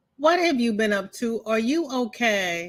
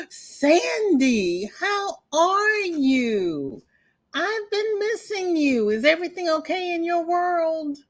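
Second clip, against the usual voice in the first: excited greeting; 8 semitones higher than usual. Real, not acted. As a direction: sing out a delighted welcome. Greeting a friend in chat.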